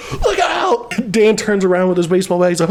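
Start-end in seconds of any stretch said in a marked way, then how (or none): 0.1-0.9 high-pitched fake alarm; hammed-up panicky warning; mock warning cry. Acted prank warning to Dan.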